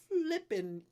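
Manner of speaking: "flippin'" punched high with a drawn-out f; stress on flippin'. Real, not acted. Streamer teasing a viewer named Flippin, "flippin'" stressed high. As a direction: hold the f, then pop "flippin'" up high. Playfully borrowing a viewer's name as expletive.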